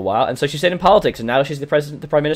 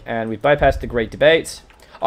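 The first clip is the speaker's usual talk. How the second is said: normal talking voice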